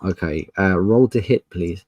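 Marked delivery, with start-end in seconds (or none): none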